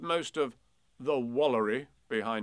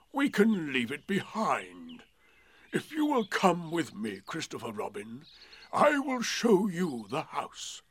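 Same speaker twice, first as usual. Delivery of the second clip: gloomy donkey voice; 5 semitones higher, 4 dB louder than usual. A narrator's reading. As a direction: speak as a weary old donkey. Eeyore voiced in story.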